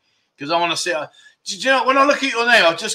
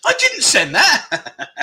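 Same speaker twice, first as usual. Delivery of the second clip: high, squeaky mock voice; a silly high voice, then drawn out slowly and laughing; imitating his thought; 10 semitones higher than usual. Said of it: "He's thinking".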